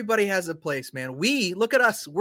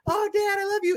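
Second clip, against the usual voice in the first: put-on much higher, sweet child voice; little girl voice; 14 semitones higher than usual. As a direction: imitate a cooing little daughter. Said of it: "And she's like".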